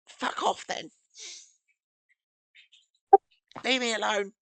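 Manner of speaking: high, shaky goblin brush-off; scared goblin voice. Acted. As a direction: tell them off, afraid underneath.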